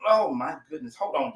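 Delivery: ordinary talk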